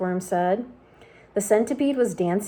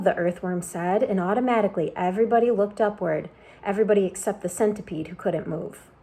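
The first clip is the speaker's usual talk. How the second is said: ordinary delivery, nothing marked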